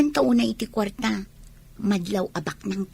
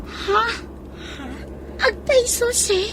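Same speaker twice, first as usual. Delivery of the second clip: high, startled question to the boss; can't believe the offer, voice jumps up; shocked disbelief; 11 semitones higher, slower than usual. Acted. Student hearing she'll live there free, startled.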